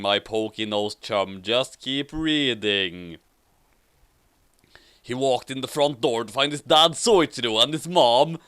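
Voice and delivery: ordinary talk, nothing marked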